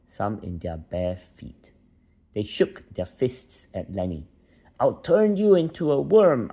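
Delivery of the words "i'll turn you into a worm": high, loud furious threat; angry threat. Acted. Villagers shaking fists at Lenny, a loud high threat. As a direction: shout it up high at the boy.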